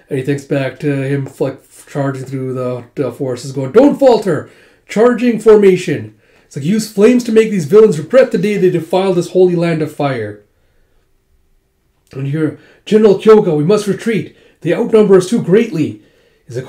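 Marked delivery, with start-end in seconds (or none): 3.7-6.1 loud, high rallying shout; battle command. Retold or imitated. Officer to his charging forces, shouted command. 6.7-10.4 raised, higher rallying voice; battle command. Retold or imitated. Officer to troops defending their land, rousing command. 12.8-16.0 loud, high call to a superior; urgent battle plea. Retold or imitated. Soldier to his outnumbered general, urgent shouted plea.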